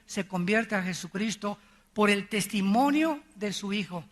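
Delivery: normal talking voice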